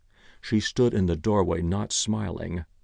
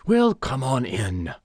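put-on slow, higher, tired old man's grumble; petulant; 4 semitones higher, 4 dB louder than usual. A narrator's reading. Old man in bed calling to his butler, petulant. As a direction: drag it out, tired and peevish. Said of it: "tired voice inside the room said petulantly".

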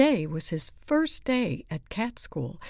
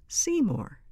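usual speaking voice

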